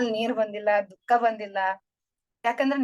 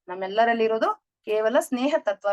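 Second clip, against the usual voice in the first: usual speaking voice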